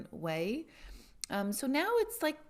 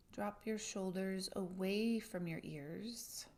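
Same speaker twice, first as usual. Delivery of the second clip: guided meditation; 8 dB quieter than usual. Acted.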